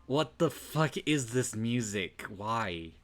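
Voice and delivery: real loud bewildered reaction; loud disbelief. Real, not acted. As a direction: yell at the music. Reacting to music.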